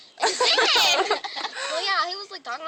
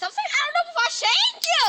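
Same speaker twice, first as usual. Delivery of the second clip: much higher, louder hammed-up threat; mock threat; 12 semitones higher, 4 dB louder than usual. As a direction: threaten him, half joking. Acted threat to the boy.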